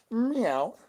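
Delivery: a high, rising meow; high cat meow. Pretend. Streamer meowing high after begging for kitty litter. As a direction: squeak it out like a cat.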